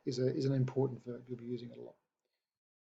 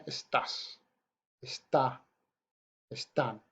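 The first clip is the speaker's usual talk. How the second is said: "Estás, está, están" each stressed on the final syllable; stress on second syllable; 3 dB quieter, slower than usual. Shown as a demonstration. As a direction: say "Estás", "está", "están" separately, leaning on tás, tá, tán. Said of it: "we place that vocal stress".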